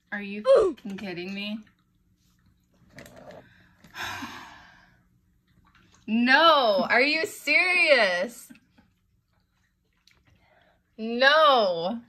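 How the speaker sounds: loud high alarmed cries; shouting. Real, not acted. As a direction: cry out in disbelief. Alarmed yelling at the bird.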